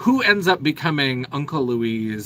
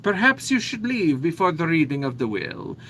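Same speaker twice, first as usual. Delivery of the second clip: put-on a theatrical Italian accent, hamming up the doom; ominous Italian fortune teller; 6 semitones higher than usual. Contessa hands out tarot cards with terrible fortunes, Italian accent. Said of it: "just tell them, like".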